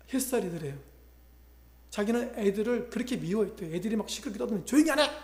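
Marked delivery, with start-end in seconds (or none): 4.7-5.1 sharp shout, much higher and louder; retold yell at kids. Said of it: "막 이렇게 떠드는 사람이었는데".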